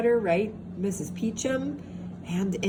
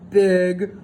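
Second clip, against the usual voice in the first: louder, roaring "big roar"; roaring narration; 2 semitones higher, 6 dB louder than usual. A narrator's reading. Narrating Papa Bear's roar, loud. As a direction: let the word roar out.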